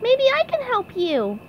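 high cartoon voice at normal volume; SpongeBob. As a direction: offer help in a squeaky pipsqueak voice. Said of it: "answered SpongeBob".

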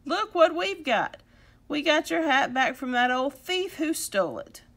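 loud, high, eager report; excited boasting. Acted. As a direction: show off your prize proudly. Boys proudly show rescued hat.

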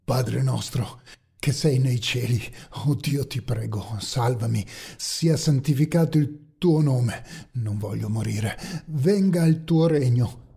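preghiera disperata. A narrator's reading. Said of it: "iniziò a pregare". (preghiera lenta con suppliche sommesse)

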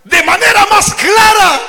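high booming cry; preaching shout. Acted. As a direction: shout it out to the church. Preaching climax. (grito de predicador)

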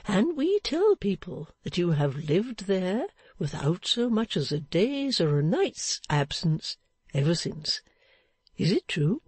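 put-on drawn-out, lilting old man's voice; good-natured old fellow, swooping intonation. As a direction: invite them in as a chatty sexton.

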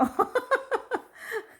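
high, loud burst of laughter; high delighted laugh. Real, not acted. Delighted at the dog.

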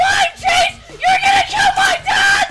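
excited shout. Real, not acted. Son yelling at dad and friend in the game. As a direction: call out in panic and glee.

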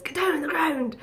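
imitated cop command in a put-on voice; Jacksepticeye shouting. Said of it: "he shouts".

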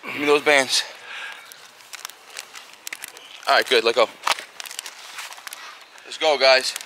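tired and strained between sets; fatigued. Real, not acted. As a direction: push the words out through fatigue. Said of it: "we're getting real fatigued now".

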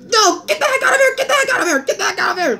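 high, urgent ordering out; frantic scolding. Acted. Father chasing his son from the show, frantic. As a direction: shoo him out in a high panic.

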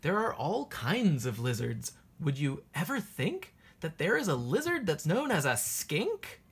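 ordinary delivery, nothing marked

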